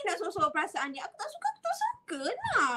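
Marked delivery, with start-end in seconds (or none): 1.1-2.7 high, whiny mock tantrum with a laugh; hammed-up childish protest, half laughing. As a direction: whine it like a sulking child who won't hide it. Acting a tantrum.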